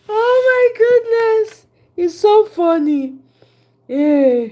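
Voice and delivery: high, slow, breathless with laughter; real laughter; laughing. Real, not acted. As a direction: crack up laughing at yourself. Laughing hard at own accent.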